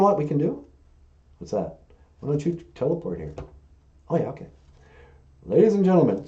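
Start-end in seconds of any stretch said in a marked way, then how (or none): none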